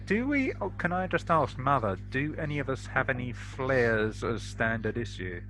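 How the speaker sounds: ordinary talk, nothing marked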